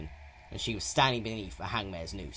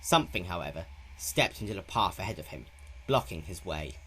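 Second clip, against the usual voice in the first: usual speaking voice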